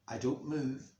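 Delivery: ordinary delivery, nothing marked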